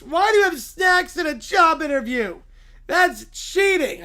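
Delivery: high, loud, indignant accusation; whiny Little Bear. Acted. Little bear spotting snacks in a job interview, indignant whine. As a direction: cry foul like an outraged cub.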